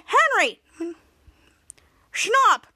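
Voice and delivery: high, loud call of a name. Retold or imitated. Teacher calling Henry back in the hallway, mock yell. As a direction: stop him in his tracks.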